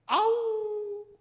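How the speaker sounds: high, drawn-out loud howl; imitated wild dog howl. Preacher replaying the wild dog sound for listeners, long howl. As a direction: throw back a long "awoo". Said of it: "그때 무슨 소리가 났다고?".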